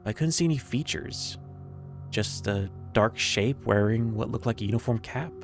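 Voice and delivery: ordinary delivery, nothing marked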